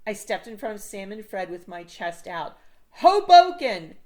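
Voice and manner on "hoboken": loud, high, slow declaration; triumphant shout. A narrator's reading. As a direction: proclaim it chest out. Joe realizes, declares boldly.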